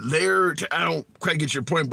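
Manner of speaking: normal talking voice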